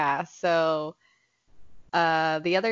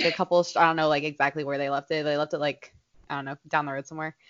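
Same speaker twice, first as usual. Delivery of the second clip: normal talking voice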